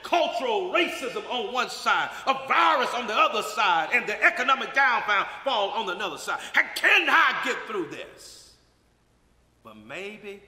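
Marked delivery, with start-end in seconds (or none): 0.0-8.5 louder preacher's plea, slowing down; preaching at full force. Acted. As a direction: lift the congregation's question high. Preaching climax.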